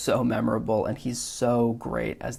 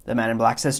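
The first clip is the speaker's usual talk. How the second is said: normal talking voice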